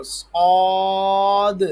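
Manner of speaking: صاد full-mouthed, long high vowel, released d at the end; heavy saad. Shown as a demonstration. Teacher models for pupils, heavy long صاد. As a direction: hold صاد loud and thick, sound the final d. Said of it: "منہ پھلا کے پڑھیں گے".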